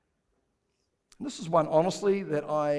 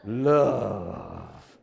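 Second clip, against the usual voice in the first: Love dropped much lower, slow and romantic; romantic Italian; 9 semitones lower than usual. Shown as a demonstration. Congregation told to say Love like Italian, crooning. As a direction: purr Love like an Italian lover. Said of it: "Pretend you're Italian".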